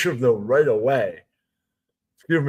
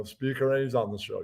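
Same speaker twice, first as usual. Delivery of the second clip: normal talking voice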